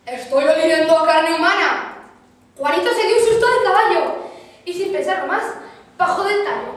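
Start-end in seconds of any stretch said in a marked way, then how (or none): 0.1-2.0 booming, louder monster voice; terrible giant voice. Acted. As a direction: boom it like an ogre from above. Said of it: "había una voz terrible".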